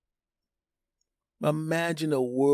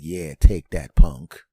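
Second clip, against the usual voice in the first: low, louder tough-guy gloat; gloating switch; 6 semitones lower, 7 dB louder, slower than usual. Acted. Light switch gloating at the owner, low tough sneer. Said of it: "the light switch is like".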